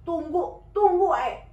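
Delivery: loud, firm "tunggu, tunggu"; sharp order. Retold or imitated. Abah ordering Neng to wait, loud and sharp.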